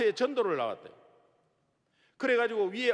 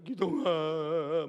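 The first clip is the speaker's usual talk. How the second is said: tearful mother; 3 semitones lower, much slower than usual. Retold or imitated. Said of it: "막 울어".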